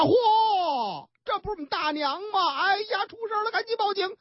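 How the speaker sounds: high, fast cry of alarm, acted; shocked alarm. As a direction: play the son finding the body, voice breaking. 儿子发现老太太躺地头. (惊慌失措地高声喊)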